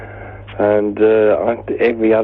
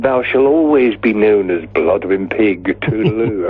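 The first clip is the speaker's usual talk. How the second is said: mock-grand stage pronouncement; Shakespearean thespian. Mate bestows a name on the band, Shakespearean thespian. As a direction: proclaim it like an awfully British thespian. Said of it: "Shakespearean thespian pose".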